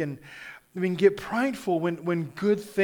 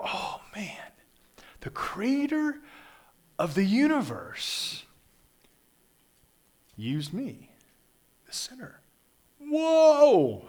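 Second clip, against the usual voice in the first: awed whisper to exclaim; 6 semitones higher, slower than usual. Pretend. A worshipper marvels that God used him, awed whisper building to whoa. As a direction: breathe it in wonder, then burst out. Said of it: "We go".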